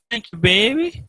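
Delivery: imitated old lady, high and rising. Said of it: "the old lady is like".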